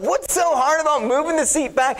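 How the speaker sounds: husband gripes about the car seat; mock complaint. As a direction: whine about the seat, half-joking. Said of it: "I'm like".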